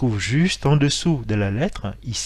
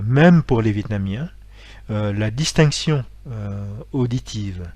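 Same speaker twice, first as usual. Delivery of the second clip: ordinary delivery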